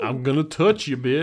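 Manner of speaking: loud, cocky jeering; cocky taunt. Pretend. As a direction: taunt with bravado. Voicing clown taunt.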